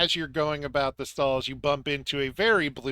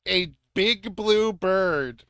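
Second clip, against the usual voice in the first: slow and louder, savouring each word; drawn out; 5 semitones higher, 5 dB louder, much slower than usual. Acted. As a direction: stretch it out for comic weight. Echoing the bird description.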